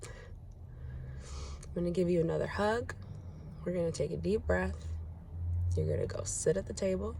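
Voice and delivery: soft, measured and firm; calm steady plan. Retold or imitated. Mom giving her son a calm plan, softly firm. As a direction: lay out the plan gently but firmly. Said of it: "I said".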